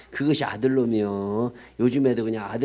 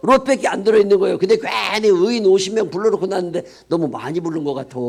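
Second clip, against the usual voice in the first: much higher, louder and faster; fiery preaching shout; 6 semitones higher, 7 dB louder than usual. Acted. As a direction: blast it out to the congregation. Preacher's outburst, very loud.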